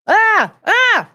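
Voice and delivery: high loud ah ah yelps; quick alarmed cries; startled yelps. Real, not acted. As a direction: yelp in sudden surprise.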